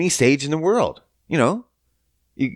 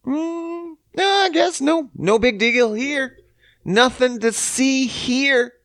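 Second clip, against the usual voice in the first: high-pitched, sarcastic dismissal; feigned nonchalance, drawn out at the end; mock innocence; 12 semitones higher, slower than usual. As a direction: pretend there is nothing wrong, voice way up. Sarcastic pretence that nothing is wrong.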